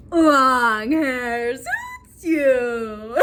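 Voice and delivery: loud, extremely drawn-out mock compliment; hammed-up smitten husband. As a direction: overdo it loudly, stretching each word out.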